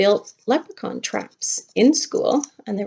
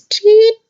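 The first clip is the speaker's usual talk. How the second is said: chirp; 12 semitones higher, 10 dB louder than usual. Pretend. Playful chirp from stick puppet.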